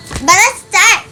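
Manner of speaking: bratty. Real, not acted. Vlogger admits she sounds bratty, bratty whine. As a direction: say it like a spoiled brat. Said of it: "I don't know why I sound like a brat".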